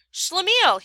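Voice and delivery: high, loud call of a name. A narrator's reading. Friend calling from the taxi door, high call. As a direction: call to him from the cab. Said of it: "he called".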